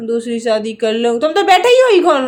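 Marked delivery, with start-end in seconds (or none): 0.1-1.2 lower, softer echo of his question; mocking echo. Wife throws husband's remarriage question back, mocking echo. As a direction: parrot him back in a dropped voice.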